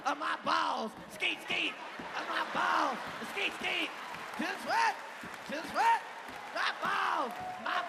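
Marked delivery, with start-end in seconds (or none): none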